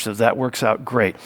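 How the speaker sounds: ordinary talk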